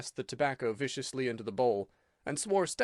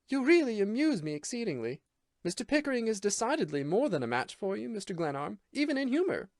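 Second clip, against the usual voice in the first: higher, mocking disdain; scornful amusement; 9 semitones higher than usual. A narrator's reading. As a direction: sneer at him politely. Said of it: "the most severe disdain".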